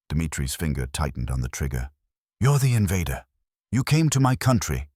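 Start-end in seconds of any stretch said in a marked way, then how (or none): 2.4-4.9 rising, higher and louder accusation; angry accusation. A narrator's reading. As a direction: throw the blame at him. Young soldier accuses enemy prisoner.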